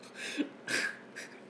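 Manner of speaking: soft breathy giggle; quiet laugh. Real, not acted. Host repeating the tongue twister, quiet giggle. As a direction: giggle under your breath.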